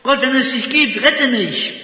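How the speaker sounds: lauter, höherer, verzweifelter Schrei um Rettung; in Todesangst nach oben schreien; panischer Schrei zu Gott. Acted. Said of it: "In seiner Todesangst schreit er zu Gott". (laut und hoch in Todesangst geschrien)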